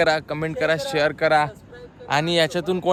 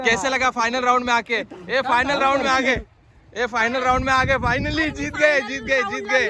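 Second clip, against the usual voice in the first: mock TV reporter; 10 semitones higher, 4 dB louder than usual. Mock Roadies reporter interview.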